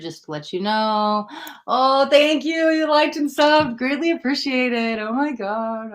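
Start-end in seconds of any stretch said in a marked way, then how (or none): 1.7-5.9 higher, gushing thank you; excited thanks. Real, not acted. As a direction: gush thanks to your viewers. Thanking viewers for support.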